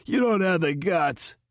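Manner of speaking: high, strained croaking defiance; high-pitched choked rasp; hoarse croak. A narrator's reading. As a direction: force the words through a sore throat. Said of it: "Chastain croaked hoarsely".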